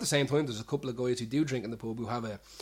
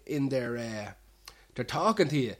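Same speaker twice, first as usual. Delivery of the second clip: normal talking voice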